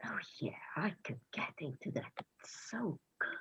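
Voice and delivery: breathy whisper of craving; hungry whisper. Acted. Boy whispering his craving for the marrow. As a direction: whisper it like forbidden food.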